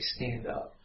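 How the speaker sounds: whispered command from an imitated dad; very soft, whispered parental threat; stern hushed order. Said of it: "Like that".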